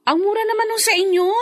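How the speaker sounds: can't believe how cheap it is; fast pleased astonishment; surprised at the price. Acted.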